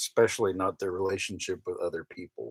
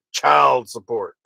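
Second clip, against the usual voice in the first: put-on "child support." slow and louder, each word punched; all caps words; 8 dB louder, slower than usual. Reading the caps part of a comment, slow and punched. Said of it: "in all caps".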